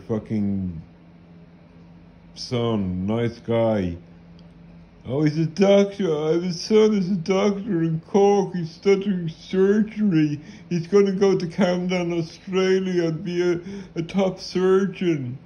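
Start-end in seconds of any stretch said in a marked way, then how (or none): 5.0-15.4 mocking boastful parent.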